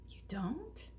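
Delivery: very soft, wondering question; barely believing it; hushed surprise. Acted. Punchinello asks Eli, hushed and wondering.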